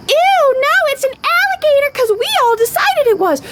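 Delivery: high, sneering mock of a know-it-all; mocking snooty voice. Mocking someone who insists a cat is an alligator, in a high snooty sneer. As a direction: look down your nose and sneer.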